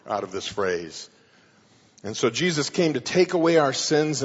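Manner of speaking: ordinary talk, nothing marked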